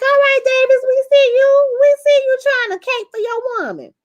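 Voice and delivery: cheering Davis on for standing up for his wife, high and loud; teasing cheer. Retold or imitated. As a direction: hype him up like a friend shouting from the couch.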